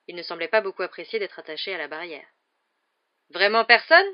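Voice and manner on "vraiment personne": loud, higher pleading question; imploring. A narrator's reading. Hagrid asking pupils for a volunteer, imploring. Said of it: "dit Hagrid, le regard implorant".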